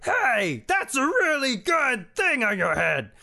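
high-pitched silly politician. Acted. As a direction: squeak it out way up high.

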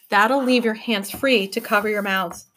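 usual speaking voice

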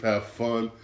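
ordinary talk

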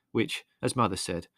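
ordinary delivery

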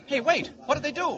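high, loud call to stop; alarmed demand; urgent shout. Acted. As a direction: holler after someone running off. Kent calls after searcher.

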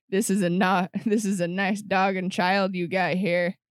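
lightly voiced mobster threat; friendly threat. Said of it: "They're space mobsters of just like".

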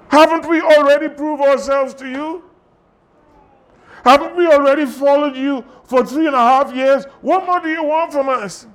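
high, exasperated pleading questions; protesting disciples. Acted. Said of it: "The disciples responded by saying".

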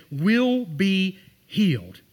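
very slow, each word laid down firmly; slow emphatic promise. Acted. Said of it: "he says".

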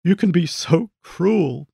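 a mild hint of a mother's pained lament; moaning with regret. A narrator's reading. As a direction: draw it out, wounded and regretful. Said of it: "moaning with regret".

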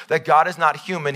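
ordinary talk, nothing marked

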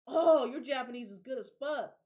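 loud, amazed praise after a gasp; mock astonished compliment; impressed surprise. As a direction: gush like a stunned local.

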